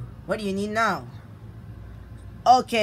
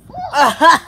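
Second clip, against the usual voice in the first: breathless laugh; 10 semitones higher, 10 dB louder than usual. Pretend. After climbing the box.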